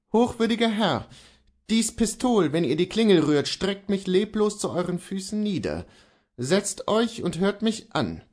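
a defiant man holds his ground; Kohlhaas voice. Acted.

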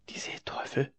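whispered, trembling with fear; scared child's whisper; frightened whisper. A narrator's reading. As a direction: breathe it out in terror between the fingers. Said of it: "raunte es zitternd zwischen seine Finger".

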